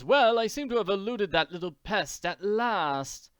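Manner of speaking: Count de Tours. Acted. Count gloating after escaping Annie, self-satisfied. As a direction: play a scheming count who thinks he got away.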